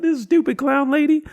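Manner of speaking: high, loud mockery; jeering. Worker laughs about the customer with a bystander, jeering. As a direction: roast her for the crowd. Roasting the lady with others.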